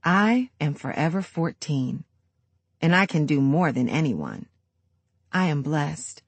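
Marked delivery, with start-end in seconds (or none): none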